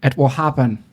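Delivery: lightly put-on Irish accent, Conor McGregor style. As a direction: quote it in a mild Irish brogue. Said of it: "can you do an Irish accent".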